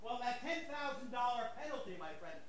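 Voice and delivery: distant, off-mic voice; speaking from across the room; far from mic. Real, not acted. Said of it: "is the camera picking me up".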